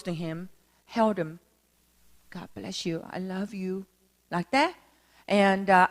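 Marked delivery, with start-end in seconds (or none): 2.3-3.8 very soft, gentle hushed blessing; tender blessing. Retold or imitated. Preacher holding the bitter old man, soft and tender. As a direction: say it softly into his ear. Hugging the man who rebuffed him.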